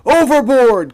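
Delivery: loud, high excited shout; a sailor shouting excitedly; excited call. A narrator's reading. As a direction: call it out in alarm. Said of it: "in an excited voice".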